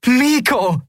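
high, joyful call; jubilant cry. A narrator's reading. Endriel into the recorder to Miko, jubilant cry. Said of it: "jubelte Endriel".

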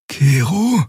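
gently calling her friend's name; Xia's voice. A narrator's reading.

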